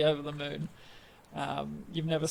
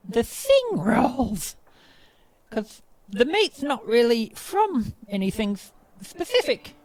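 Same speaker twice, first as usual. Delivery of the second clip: proud goblin chef; 8 semitones higher, 7 dB louder than usual. Acted. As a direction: present each dish grandly in a high goblin voice. Goblin host keeps presenting dishes.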